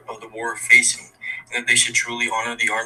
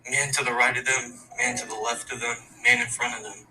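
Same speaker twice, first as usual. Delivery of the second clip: poem recitation. Acted. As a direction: recite it like a memorial verse, steady and grave. Student recites own war poem.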